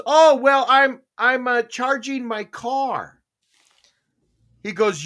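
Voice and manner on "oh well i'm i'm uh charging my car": higher, drawn-out mock explanation; retold brazen excuse. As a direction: imitate a caught neighbor explaining herself. Retelling neighbor caught using his charger.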